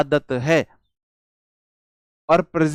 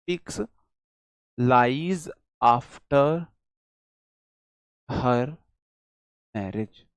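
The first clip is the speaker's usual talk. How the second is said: slow, with long pauses between words; teacher dictating, fading at the end; slow dictation; 4 semitones lower than usual. Shown as a demonstration. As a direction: dictate word by word to the class. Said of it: "speaks आएगा".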